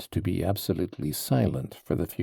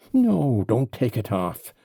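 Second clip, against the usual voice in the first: a sharp No, louder and higher, then a cross command; furious cry; 5 semitones higher, 6 dB louder, slower than usual. A narrator's reading. Mitya refuses the ring, crying out furiously. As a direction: cut him off in anger. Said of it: "cried Mitya furiously".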